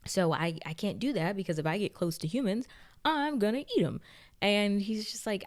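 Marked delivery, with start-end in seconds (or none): 3.0-4.0 comic squeak. Retold or imitated. Vampire Louis joke.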